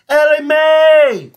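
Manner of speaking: long, drawn-out high holler; yelling across a field. Acted. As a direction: call her name from four hundred acres away. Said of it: "hoping she can even hear him yelling in the distance".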